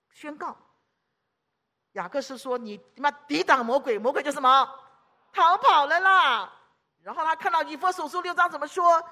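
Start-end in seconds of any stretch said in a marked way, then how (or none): 2.6-6.5 defiant shout at the devil; forceful proclamation. Retold or imitated. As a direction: proclaim victory over the devil. Quoting scripture at the devil.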